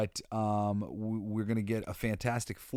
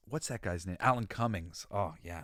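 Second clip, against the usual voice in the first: ordinary delivery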